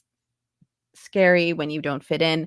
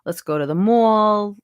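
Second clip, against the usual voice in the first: New York accent; 3 dB louder than usual. Shown as a demonstration. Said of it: "I talk like this".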